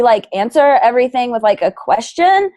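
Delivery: rising question tone on every phrase; upspeak. Shown as a demonstration. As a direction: end each phrase going up like a question.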